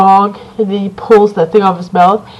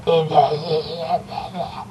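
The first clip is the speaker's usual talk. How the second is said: barely audible mumble; 4 semitones lower, 10 dB quieter, slower than usual. Retold or imitated. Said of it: "you can, like, barely hear what he's saying".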